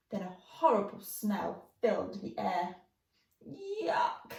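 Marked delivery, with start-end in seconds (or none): 3.5-4.3 high, grimacing yuck; disgusted yuck. Acted. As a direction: spit it out with a grimace at the smell. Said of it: "grimaced George".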